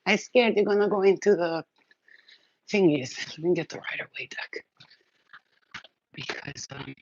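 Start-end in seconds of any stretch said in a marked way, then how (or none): 3.3-6.9 soft unvoiced whisper; hushed whispered aside. Real, not acted. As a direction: whisper it to yourself under your breath. Same line repeated in a whisper.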